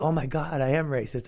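hushed mock horror; quiet imitated confession; mock guilty realization. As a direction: say it softly, as if ashamed.

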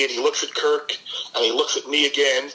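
ordinary talk, nothing marked

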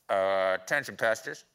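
low, dopey pilot on the intercom; clueless captain announcement; airport announcement. Pretend. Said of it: "in-flight announcements".

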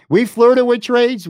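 laughing, high and slow. Real, not acted. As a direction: laugh the words out. Laughing at their own indecision.

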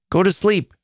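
put-on mother ordering her child back to bed; sharp command. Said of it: "she commanded sharply".

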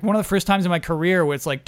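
normal talking voice

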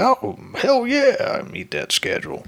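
loose Macho Man growl with a hint of a country accent. Effects artist bragging he can meet the deadline, Macho Man voice with a hint of country accent. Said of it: "his accent justice".

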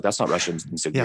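understated, unbothered mock denier; offhand retold denial; dismissive denial. Voicing denier in retold exchange.

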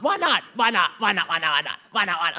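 lightly acted friends egging each other on; breezy mock enthusiasm; mock carefree agreement. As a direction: rattle off WHY NOT over and over.

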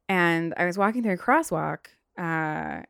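ordinary delivery

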